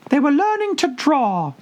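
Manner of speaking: a tiny creature answering after a long think; squeaky Dormouse. A narrator's reading. The sleepy Dormouse goes on.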